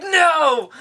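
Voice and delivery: high-pitched, hammed-up refusal; mock dramatic cry. Son refuses villain's offer, mock dramatic cry. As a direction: cry it out like an overacted movie hero.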